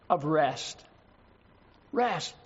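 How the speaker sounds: rest drawn out and leaned on; emphasis on the word rest; stress on rest. Shown as a demonstration. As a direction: lay the weight on "rest", slow and falling. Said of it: "I want to put emphasis on the word rest".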